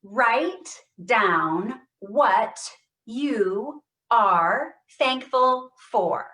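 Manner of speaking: very slow, loud, voice thrown forward; projecting up and over. Shown as a demonstration. Therapist projecting her voice over the computer, very slow and loud. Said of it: "let's try it together".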